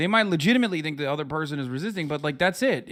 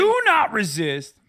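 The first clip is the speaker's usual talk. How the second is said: higher, louder mocking cop order; mock cop command; 6 semitones higher, 6 dB louder than usual. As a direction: parody a cop barking. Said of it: "people always say".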